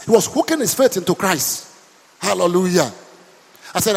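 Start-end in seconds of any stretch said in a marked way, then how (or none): none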